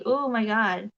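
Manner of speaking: normal talking voice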